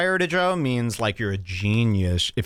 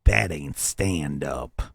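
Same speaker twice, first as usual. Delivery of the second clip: slow deep mocking impression; 10 semitones lower, much slower than usual. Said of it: "God bless him".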